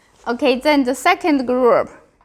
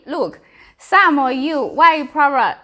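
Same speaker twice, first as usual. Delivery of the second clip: ordinary talk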